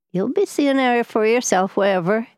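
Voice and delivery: high, singsong Highland Scots; Highland dialect. A narrator's reading. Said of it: "soft, slow, almost unintelligible Highland dialect".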